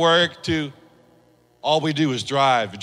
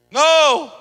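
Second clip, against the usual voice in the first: mock indignant no; 10 semitones higher, 5 dB louder than usual. Acting out refusal to forgive.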